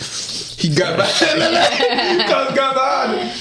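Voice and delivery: high-pitched, laughing as he tells it; breaking up with laughter mid-story; giggly high retelling. Real, not acted.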